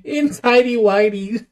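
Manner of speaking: high, slow laughing delivery. Real, not acted. Friend chiming in about the underwear scene, laughing. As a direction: crack up while getting the words out.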